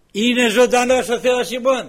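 loud, high preaching cry; fervent proclamation. Real, not acted. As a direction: proclaim it to the congregation.

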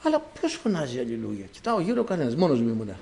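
soft, bewildered wondering aloud; quietly retold bafflement; hushed puzzlement. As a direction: wonder under your breath who is calling. Puzzled at hearing an unseen voice.

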